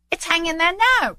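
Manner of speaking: high-pitched lad; boy's voice. Acted. Boy tells Scrooge the turkey hangs there, high boyish. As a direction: call it up in a boy's treble. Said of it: "replied the boy".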